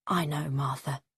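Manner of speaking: soft whispered comfort. A narrator's reading. Husband comforting his sad wife, whispered. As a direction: whisper it gently to her.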